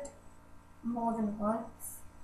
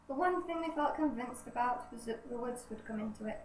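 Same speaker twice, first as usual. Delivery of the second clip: lightly raised book-reading voice; reading aloud; 3 semitones higher than usual. Acted. Reading a novel aloud to the camera while juggling, slightly raised reading voice.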